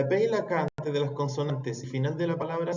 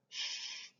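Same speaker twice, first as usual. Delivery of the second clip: soft shushing hiss; 16 dB quieter than usual. Shown as a demonstration. Teacher demonstrating the sh sound. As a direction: shush like quieting a room. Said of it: "sonido de hacer callar".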